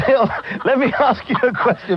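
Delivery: trying to ask but cracking up with laughter; laughing. Real, not acted. Host laughs at caller's joke while asking, real laughing.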